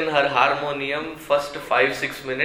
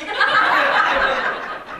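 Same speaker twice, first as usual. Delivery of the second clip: high giggle. Real, not acted. Laughing at the backbench joke.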